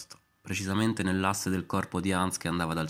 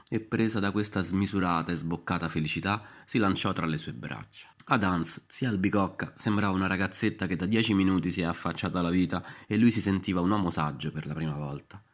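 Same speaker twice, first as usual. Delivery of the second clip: ordinary delivery, nothing marked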